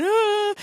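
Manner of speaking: high, loud mock wail; imitated child's crying. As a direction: wail like a crying little girl. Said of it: "my sister was crying".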